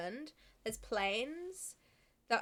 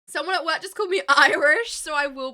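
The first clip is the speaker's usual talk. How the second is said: loud outrage; 4 semitones higher, 15 dB louder than usual. Real, not acted. Outraged at being called Irish.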